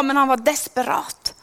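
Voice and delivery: ordinary talk, nothing marked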